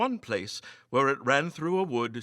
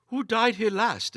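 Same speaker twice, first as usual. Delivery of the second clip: polite derision; 9 semitones higher, 4 dB louder than usual. A narrator's reading. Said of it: "with an air of polite derision".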